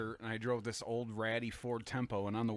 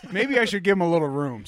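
louder, higher wry thought; loud self-talk; 5 semitones higher, 11 dB louder than usual. Retold or imitated. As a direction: talk yourself into caution. Drunk driver self-talk.

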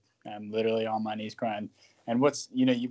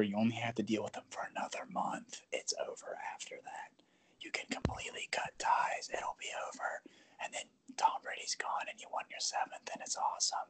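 whisper; 8 dB quieter than usual. Pretend. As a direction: coax him in a hushed secret voice. Secret sales pitch to the owner.

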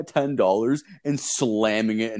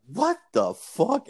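high, slow shocked cry; stunned what the fuck, pitched way up; 12 semitones higher, slower than usual. Retold or imitated. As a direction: react in disbelief, voice jumping up. Shocked reaction retold.

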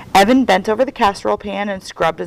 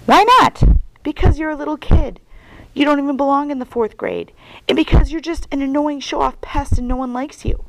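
little sister and brother quarrel; 7 semitones higher than usual. Acted. Kid sister demands why, brother taunts back higher-pitched. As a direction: voice the kids' fight higher and sharper.

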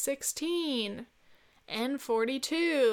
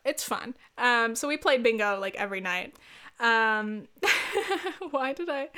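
normal talking voice

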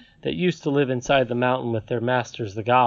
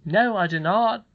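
put-on polite boy, higher voice; respectful; 8 semitones higher, 4 dB louder than usual. A narrator's reading. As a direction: answer firmly but respectfully. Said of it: "returned Curdie respectfully".

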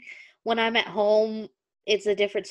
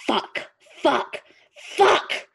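from muttered to shouted curses; building angry swearing; 5 semitones higher, much slower than usual. Acted. Character swears in shock.

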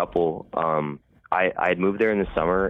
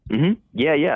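excited yes; 11 semitones higher, 4 dB louder, slower than usual. Real, not acted. Recognizes friend's name.

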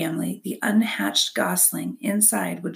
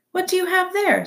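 high, nosy fox voice; curious fox; 12 semitones higher, 4 dB louder than usual. A narrator's reading. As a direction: lean in like a sly fox. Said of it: "said Fink".

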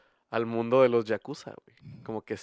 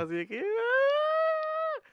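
mock scream; much slower than usual. Sara screaming on a chair.

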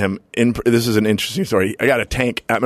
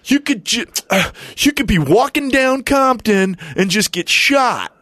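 much higher, slow put-on voice; imitating Chemo; 12 semitones higher, slower than usual. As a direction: drag it out in the fighter's high voice. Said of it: "That's how he talked".

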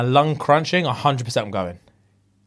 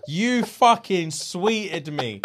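normal talking voice

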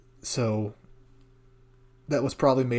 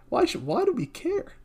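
exasperated. Real, not acted. Exasperated rant ending.

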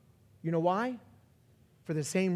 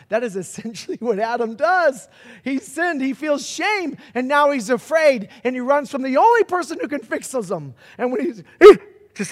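loud, high, driving sermon voice; fiery preaching; 8 semitones higher, 12 dB louder than usual. Acted. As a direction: preach it at full force. Preaching at full force.

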